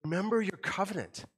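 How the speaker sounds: a prophet begging God to remember his promises; plaintive cry. Retold or imitated. Habakkuk pleading with God, a plaintive mock cry. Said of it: "he gives this plaintive cry".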